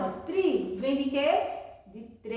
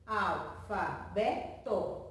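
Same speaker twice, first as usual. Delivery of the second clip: "Alfabeto" very slowly as al, fa, be, to; syllable by syllable; 5 semitones lower, 4 dB quieter, much slower than usual. Shown as a demonstration. Teacher counting four pieces for pupils, "Alfabeto" slowly spaced as al-fa-be-to. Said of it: "Quatro pedacinhos".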